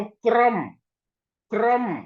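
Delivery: क्रम with r joined to k, clear demo; क्रम said slowly and clearly, kr as one cluster; क्रम, the r glued to the k; say kram. Shown as a demonstration. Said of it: "जब मैं बोलता हूं".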